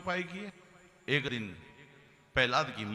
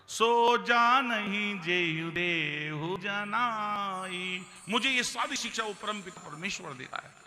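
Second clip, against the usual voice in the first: very slow, high reverent recitation; devout recitation; 6 semitones higher, slower than usual. Acted. Prahlad answering his father with folded hands, reverent chant. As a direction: recite it as a holy truth.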